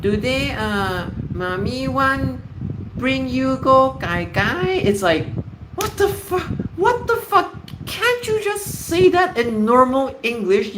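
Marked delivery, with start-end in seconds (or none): 0.0-4.8 mock baby-talk, high and drawn out; cutesy parent baby-talk. Parent asking child about going out, mocking baby-talk. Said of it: "who talks like this to, to children?". 5.8-10.8 high-pitched incredulous squawk; exasperated disbelief. Acted. Speaker ranting at parents' baby-talk, exasperated.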